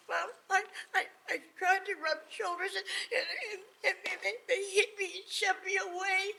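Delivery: a simple son crying to his mom; a high, tearful complaint to mom; whimpering son. Acted. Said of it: "he went home and said".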